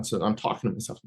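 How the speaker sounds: normal talking voice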